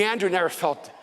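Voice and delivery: normal talking voice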